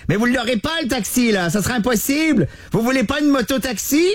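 high, eager street hustler voice; high-voiced biker. Driver offers the host a moto-taxi, high and eager. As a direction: pitch it up like a keen driver.

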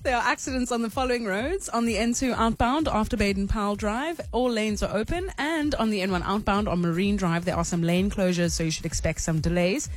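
brisk radio traffic read; traffic report. Acted. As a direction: read the roads like a traffic desk.

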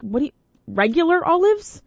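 high, rising disbelief; mock baffled high question; incredulous. Host to wife about olives, high incredulous. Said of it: "I was like".

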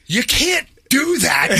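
high, slow mock angry shout; pissed. Real agent scolds the goofball dad, mock furious shout. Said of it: "the F B I guy is so pissed".

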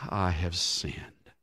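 sighing confession. A narrator's reading. King Saul finally lays down his arms and confesses, sighing. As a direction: let it out on a sigh, slowly. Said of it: "says with a sigh".

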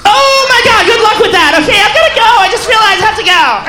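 put-on high, loud freaked-out girlfriend; horrified girlfriend. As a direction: recoil and bolt in alarm.

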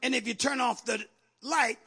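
normal talking voice

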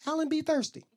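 small, high, soft voice; high, quiet mock innocence; sheepish excuse. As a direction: offer a feeble excuse meekly. Acts his feeble excuse to girlfriend.

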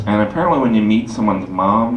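usual speaking voice

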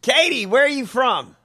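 loud, high call to her; mock call-out. Real, not acted. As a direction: shout the question at her. Calling out to her.

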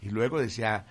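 ordinary talk

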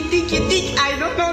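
normal talking voice

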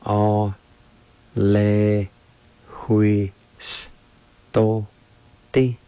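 "O le hui s to ti" in isolated syllables, long pauses between; teacher separating each sound of "O le hui s to ti"; syllable-by-syllable demo. Shown as a demonstration. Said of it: "It's pronounced like this".

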